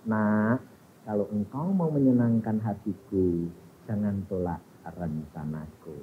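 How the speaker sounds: very low, soft, solemn voice; voice of God. Retold or imitated. God speaking to the young woman, low and hushed. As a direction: speak gently and gravely as God. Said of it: "Tuhan berbicara kepada perempuan ini".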